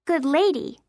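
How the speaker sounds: lightly put-on correction; mock deep voice. A narrator's reading. Girl corrects her friend within the game, lightly voiced knight. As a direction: correct him in a play-acting knight voice. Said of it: "I corrected in my deep voice".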